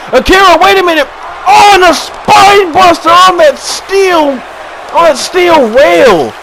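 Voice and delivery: excited shout. Real, not acted. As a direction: erupt as the big slam lands. Commentator erupts at big move.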